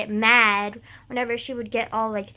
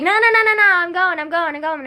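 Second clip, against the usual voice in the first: backing off in a frightened rush; mock terrified retreat, higher and louder; scared boy; 5 semitones higher, 9 dB louder than usual. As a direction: panicky high pleading, much louder. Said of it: "scared of me every time he saw me".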